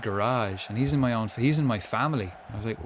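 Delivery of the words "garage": garage as guh-RAHZH, second syllable stressed; cousin's American pronunciation. Retold or imitated. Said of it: "And he said".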